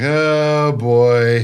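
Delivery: loud groan. Retold or imitated. Repeats reaction louder.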